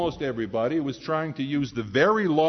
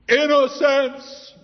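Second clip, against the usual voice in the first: INNOCENCE cried out much higher and louder, stretched slow; joyful shout; 11 semitones higher, 6 dB louder, much slower than usual. Acted. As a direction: shout it like a sudden discovery. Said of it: "shouted the impossible thing".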